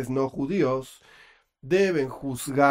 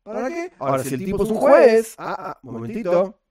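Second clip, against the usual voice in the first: lightly voiced sage laying down the law, a bit louder in places; mock Ramban speaking; 5 semitones higher, 3 dB louder than usual. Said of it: "Esto es lo que diría, diría el Ramban".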